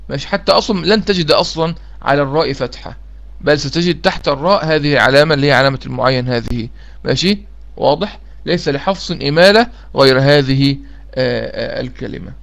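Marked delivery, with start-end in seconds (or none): none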